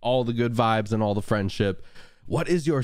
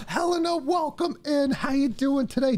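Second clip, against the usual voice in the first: excited welcome; 14 semitones higher than usual. Real, not acted. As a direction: gasp into a very high-pitched greeting. Gasps and greets arriving viewer.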